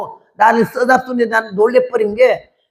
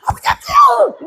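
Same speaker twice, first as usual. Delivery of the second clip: very high imitated cry; high-pitched mimicked wail; mock prayer shriek. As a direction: shriek it like frantic praying maidens. Mimics young women praying aloud.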